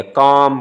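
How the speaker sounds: काम slowly, आ held long, no l; long vowel. Shown as a demonstration. Teacher demonstrating a lengthened sound, काम held long. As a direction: draw out काम. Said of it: "इस आवाज़ को हम लंबा करते हैं".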